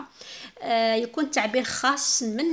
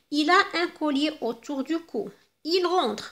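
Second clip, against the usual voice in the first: higher, louder example sentences; emphatic example reading; 5 semitones higher, 4 dB louder, much slower than usual. Shown as a demonstration. Teacher reading example sentences aloud, higher and louder. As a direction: read the example out brightly. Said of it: "teacher reads example sentences".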